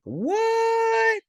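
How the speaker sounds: sudden very high outraged "What?"; shocked squeal. Retold or imitated. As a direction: explode into a squeaky disbelieving What. Cole flips the switch, outraged.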